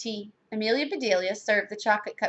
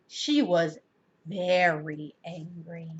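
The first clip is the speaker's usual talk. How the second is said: low, soft, drawn-out narration; ominous understatement; 5 semitones lower, 5 dB quieter, slower than usual. A narrator's reading. Narrator on furious Missus Ralph, ominous. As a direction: draw it out slowly and darkly.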